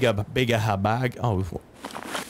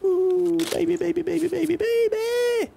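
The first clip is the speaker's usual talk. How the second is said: falsetto delight. Real, not acted. Excited at loot find.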